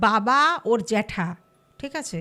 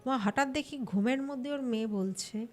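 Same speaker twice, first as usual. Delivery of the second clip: usual speaking voice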